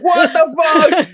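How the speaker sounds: high, slow, shouted alarm; the chicken screaming at the fan; panicked chicken. Pretend. Voicing the chicken in motor.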